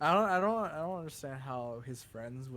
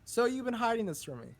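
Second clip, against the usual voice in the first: mock accusing; 9 semitones higher, 7 dB louder than usual.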